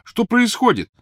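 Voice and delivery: calling out. Acted. Ron shouts through wall.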